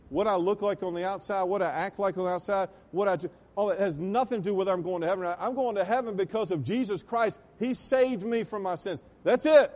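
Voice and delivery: high, loud, building preacher's cry; preaching at full force. Acted. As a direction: drive it home to the congregation, rising to a peak.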